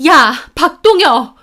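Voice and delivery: high, loud furious yell; angry shout. A narrator's reading. Sister confronts her brother on coming home, angry shout. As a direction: yell the name out in anger. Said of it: "화가 나서 오빠에게 소리를 질렀습니다".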